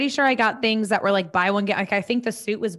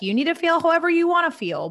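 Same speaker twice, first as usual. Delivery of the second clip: high, fast exasperated retort; mock impatient reply to followers; 8 semitones higher, faster than usual. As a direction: toss it back impatiently. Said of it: "And I'm just like".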